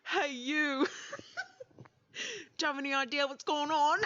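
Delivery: high giggly voice breaking into laughter; trying to talk but cracking up; laughing through it. Real, not acted. Player giggling at her friends.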